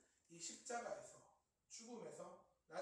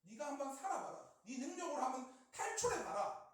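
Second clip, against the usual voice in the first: raised, goading challenge; mocking temptation; 6 semitones higher, 6 dB louder than usual. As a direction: jeer and dare him to prove himself. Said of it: "조롱하고 유혹하고 있다". (조롱하며 부추기는 말투)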